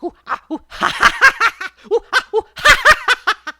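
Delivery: very high, loud ha-ha between pained oohs; yelps mixed with laughter. A narrator's reading. Brer Rabbit poked and pricked but laughing, shrieky high cackles. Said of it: "he keeps laughing".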